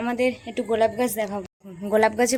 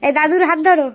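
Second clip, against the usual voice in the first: calling out instructions; 6 semitones higher, 7 dB louder than usual. Real, not acted. Mother tells child Rimi to hold grandparents' hands, calling out. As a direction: call out to the little one.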